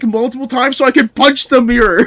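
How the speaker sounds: ordinary talk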